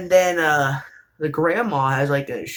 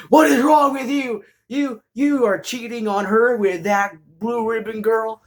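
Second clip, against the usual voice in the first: outraged grandma; 9 semitones higher than usual. Retold or imitated. Grandma scolding the main character.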